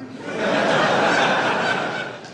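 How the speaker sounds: a real burst of breathy laughter; laughing. Real, not acted. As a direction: laugh at your own punchline. Laugh at own joke.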